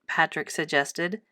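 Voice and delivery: ordinary delivery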